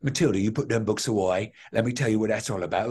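stage Cockney dad from a musical; playful Cockney character voice; Cockney accent. Acted. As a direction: put on a Cockney accent for the role. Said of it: "have to talk in a Cockney accent".

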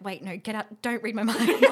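loud and laughing on the last words; laughing protest. Retold or imitated. Begging the therapist to stop reading her mind, laughing loudly. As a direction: protest through rising laughter.

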